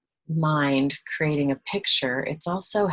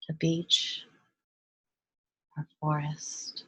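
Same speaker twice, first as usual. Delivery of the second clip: soft, hushed meditation voice with long pauses; guided meditation; 5 dB quieter than usual. Real, not acted. Guide leading listeners to relax and breathe, hushed meditation voice. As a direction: guide them gently into relaxation, softer and unhurried.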